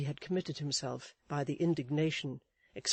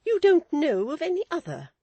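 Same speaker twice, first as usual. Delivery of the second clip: much higher, louder, indignant; indignant retort; 13 semitones higher, 12 dB louder than usual. A narrator's reading. Wife repeating her husband's words, indignant and loud. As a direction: throw it back at him. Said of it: "retorted Missus Chick".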